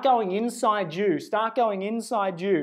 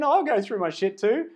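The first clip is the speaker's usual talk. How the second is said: normal talking voice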